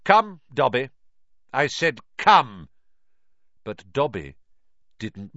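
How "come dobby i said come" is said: loud, curt order; mildly angry, louder command; stern command. A narrator's reading. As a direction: order a servant to heel. Villain ordering his servant.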